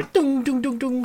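high, ringing bounce noises; imitated clanging. Shown as a demonstration. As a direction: mimic a dropped metal cup clanging. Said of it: "Did it make the sound".

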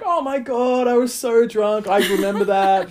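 high-pitched mock "oh my God" gush; mortified girl imitation. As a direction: imitate a woman realising her drunken blunder. Mortified girl's reply, retold.

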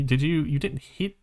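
usual speaking voice

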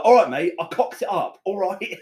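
high, squeaky imitation of a bad Scottish accent; mock Scottish leprechaun. Friend's failed Scottish accent mocked, high leprechaun voice. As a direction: mimic a friend's botched Scottish. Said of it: "he tried to do a Scottish accent, it came out like a little leprechaun".

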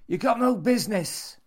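high, loud territorial outburst; possessive old man, angry. A narrator's reading. Old man clutches his book away from Jimmy, angry. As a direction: claim it fiercely, pitched high and loud.

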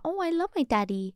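imitating innocent kids; naive child voice. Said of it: "Vi tänkte bara".

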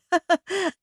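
short, high nervous giggle; small self-conscious chuckle; nervous laugh. A narrator's reading. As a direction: laugh awkwardly, briefly. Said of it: "I laughed nervously".

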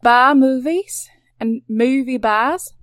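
slow Aussie wordplay on "bar" with a rising tune; Australian accent, savoring the pun; drawn-out Australian accent. Pretend. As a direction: stretch "bar movies and movie bars" in an Australian accent. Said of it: "that's the end of the Australian accent".

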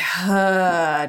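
nice big audible sigh out. Shown as a demonstration. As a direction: release a full breath with a sigh. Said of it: "with a nice big sigh".

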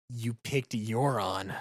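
low, slow, measured delivery; quiet, deliberate reproach; stern disbelief. Acted. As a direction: say it slowly and low, letting it sink in. Admiral addresses party, then repeats it.

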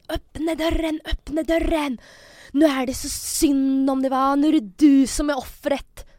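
imitated screaming. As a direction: mimic someone screaming through a locked door. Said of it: "hon skriker konstant hela tiden".

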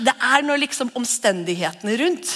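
ordinary talk

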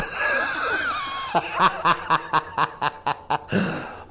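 high, helpless laughter; long laugh. Real, not acted. Manager cracks up at his own story, long real laugh. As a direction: laugh on and on at the punchline.

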